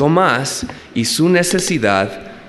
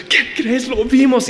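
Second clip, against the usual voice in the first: emocionado; 10 semitones higher than usual. Retold or imitated. Discípulos anuncian la resurrección emocionados.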